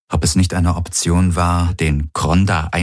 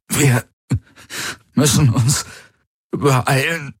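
fighting for every word; 8 semitones higher, slower than usual. A narrator's reading. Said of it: "als würde er um jedes Wort kämpfen".

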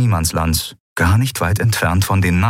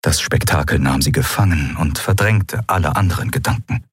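normal talking voice

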